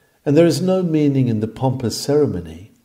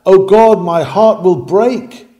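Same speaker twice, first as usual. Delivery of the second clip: loud, higher cry of a broken man; anguished groan; 6 semitones higher, 6 dB louder than usual. Retold or imitated. A man in the corner groaning to God, loud pleading cry. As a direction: cry it out as if your heart is breaking. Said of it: "groans out".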